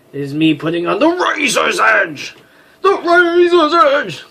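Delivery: hammed-up heroic outburst; dramatic cry. Warrior dramatizing her danger, hammed-up cry.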